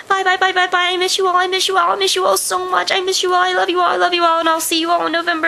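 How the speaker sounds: high-pitched excited farewell rush; gushing goodbye. Real, not acted. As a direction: gush your goodbyes to friends.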